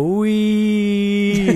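high, long-held monotone "wee"; robotic voice. Retold or imitated. Player mimics the game's voice when launched, robotic monotone. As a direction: imitate a robot, stretched on one steady note. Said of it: "like, robotic voice go".

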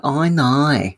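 high, exaggerated Aussie "I know", with O turned to "eye"; Kylie-type Australian accent. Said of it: "I instead of O".

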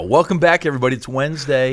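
high, loud upbeat greeting to listeners; host welcome. Acted. Host welcoming listeners back to the show, upbeat and loud. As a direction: greet the audience like a cheerful radio host.

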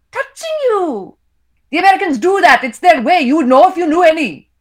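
put-on high, loud accusing wife with an Indian accent; Indian mother's voice. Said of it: "I read in my characters' voices".

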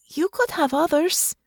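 high, begging plea; pleading. A narrator's reading. Natasha begging Russo to stay, pleading. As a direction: ask her to stay, pleading. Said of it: "her voice sounded more pleading".

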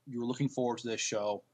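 usual speaking voice

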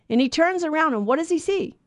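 ordinary delivery

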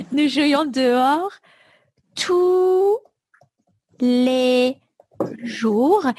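slow, word-by-word dictation; dictation pace. Shown as a demonstration. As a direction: space each word for dictation. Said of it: "dictation of a sentence".

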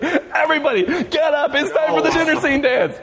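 high called-out order; mock yell. Friend calls the crowd up for a fake dance, high shout. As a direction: call out high to the whole theater. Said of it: "he jumps up and he goes like".

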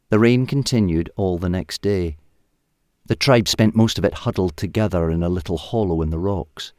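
ordinary delivery

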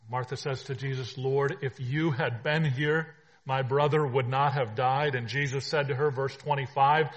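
loud ringing proclamation; preaching at full force. Acted. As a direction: declare it to the whole room.

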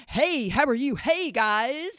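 loud, bouncy over-eager greeting; peppy youth pastor. Retold or imitated. Youth pastor greeting the kids with nauseating pep, loud and bouncy. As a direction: ham up a hyper youth pastor. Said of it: "the nauseating energy".

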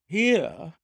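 high, loud, startled question; astonished. A narrator's reading. Ryder hearing the goose came here, astonished. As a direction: blurt it back in high surprise.

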